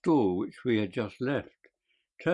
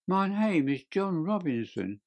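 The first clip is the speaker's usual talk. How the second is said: evasive; 7 semitones higher than usual. A narrator's reading. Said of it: "he answered with a sidelong glance".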